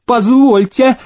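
very high-pitched indignant interjection; squeaky, won't back down; insistent protest. A narrator's reading. Nobleman refusing to let Demidov's claim pass, insistent protest. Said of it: "не унимался проезжий".

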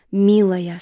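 громкий окрик шёпотом; окликни громко; резкий окрик. Acted. Мать торопит дочь. (мать громко подгоняет дочь)

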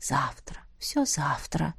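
low, whispery reassurance to put off talk; hushed reply. A narrator's reading. Man covering the girl with a blanket, hushed low reply. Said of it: "ответил, быстро накрывая её одеялом".

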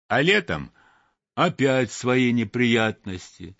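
ordinary delivery, nothing marked

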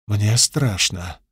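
whispered fear. A narrator's reading. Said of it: "шепнула".

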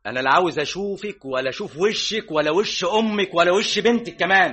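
loud, high, escalating rage; enraged husband driving out wife and in-laws; furious shout. Acted. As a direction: order them all out at full fury. Furious eviction of family.

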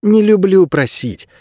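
put-on slow, high boyish voice; high-pitched lad, taking his time; boy's voice. A narrator's reading. Boy shrugging off a question, high slow boyish voice. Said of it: "пожал плечами Леви".

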